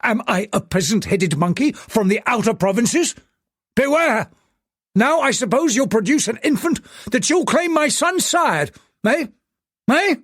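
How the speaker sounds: furious tycoon. Acted. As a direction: bark him down as a liar. Angry tycoon tirade on the phone.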